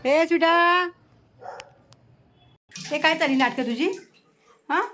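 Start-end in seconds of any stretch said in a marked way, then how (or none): 0.1-0.5 loud high call to the dog; sharp loud call for the dog. Real, not acted. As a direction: call out to the dog across the yard. Calling the dog outside.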